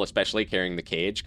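normal talking voice

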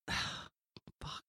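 breathless whispered swear; whispered inner curse. Retold or imitated. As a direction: mutter it under your breath in dread. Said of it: "And I thought".

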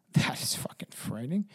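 soft, whispered reaction; hushed whisper. Real, not acted. Host reacting to the dead mother's voice, hushed whisper. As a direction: whisper it, spooked by the story.

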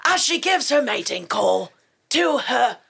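David Attenborough. Acted. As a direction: narrate wildlife in a British accent. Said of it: "the David Attenborough, like, impression, impersonation".

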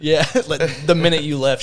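normal talking voice

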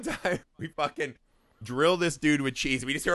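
ordinary talk

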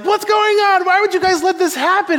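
high, quick, agitated outburst; all amped up. Acted. David amped up at the soldiers, high frantic protest. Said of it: "like, all amped up".

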